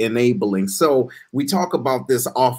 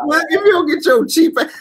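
higher, excited mock rant; mock outburst; 13 semitones higher than usual. Host erupting at the cheap friend, high and excited. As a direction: blurt it out in disbelief.

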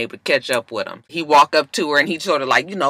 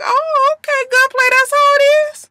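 much higher, sarcastic sing-song; 4 dB louder than usual. Retold or imitated. Reacting to [name] with high sarcastic offense. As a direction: drip with mock offense. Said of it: "I was like".

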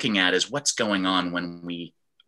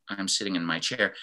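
apathetic; 3 dB quieter than usual. Shown as a demonstration. Teacher showing the emotion behind the words, apathetic. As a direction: say it with the excitement drained away. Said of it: "emotion that's behind what I'm saying".

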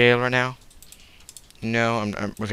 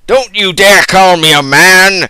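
loud, high furious mom yell; mock shout; 8 semitones higher, 15 dB louder than usual. Mother yelling at her son, loud and high. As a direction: scream it like an outraged mother. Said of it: "And she was like".

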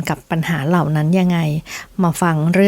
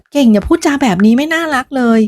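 higher, firm scolding tone; 6 semitones higher than usual. Acted. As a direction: tell her off sharply. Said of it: "โดนพี่ดุไปอีก". (ดุด้วยน้ำเสียงเข้ม)

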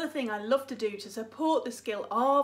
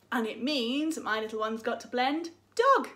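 ordinary delivery

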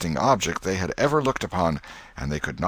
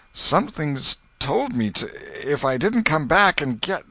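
higher, quavering confession; a frightened man barely holding on; shaken Potter; 9 semitones higher than usual. Acted. Potter, shuddering on the ground, explains himself, frightened.

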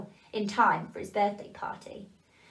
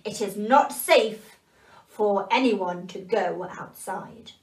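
loud and very slow command; stern grandfather warning; 5 dB louder, slower than usual. Acted. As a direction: order them firmly to stay in. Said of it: "the grandfather elf spoke to them".

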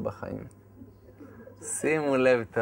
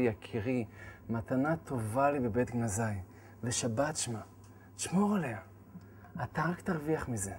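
gentle divine voice; 2 semitones lower, 9 dB quieter than usual. Retold or imitated. God telling him to keep Shabbat, gently. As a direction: speak like a loving father. God's voice speaking kindly.

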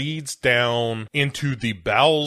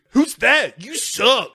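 very high-pitched mock yelp; bratty startled Milo; 11 semitones higher, 3 dB louder than usual. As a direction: snap back at an unseen stranger. Playing Milo answering the voice.

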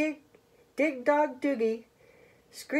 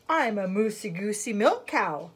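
ordinary delivery

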